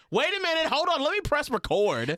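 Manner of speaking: high-pitched frantic halt; squealed mock panic; urgent interjection. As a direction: blurt it way up high, stopping someone. Interrupting to start recording.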